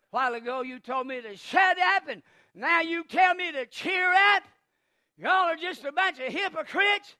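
loud, rising mock indignation; acted protest, voice climbing; indignant retort. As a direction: throw it back at the crowd. Acted retort to hypocritical crowd.

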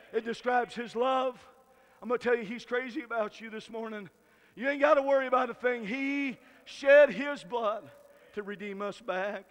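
ordinary delivery

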